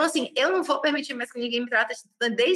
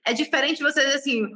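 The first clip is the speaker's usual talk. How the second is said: ordinary delivery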